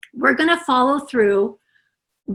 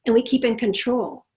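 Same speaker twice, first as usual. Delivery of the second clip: normal talking voice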